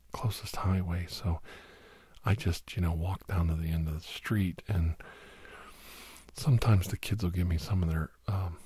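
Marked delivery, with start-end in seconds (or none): none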